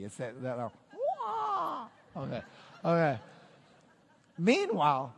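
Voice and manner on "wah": high-pitched martial-arts cry; Bruce Lee. Retold or imitated. Preacher spoofing a fight challenge, high martial-arts cry. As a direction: let out a high, drawn kung fu yelp. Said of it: "Bruce Lee".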